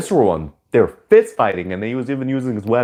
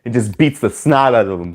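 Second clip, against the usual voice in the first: usual speaking voice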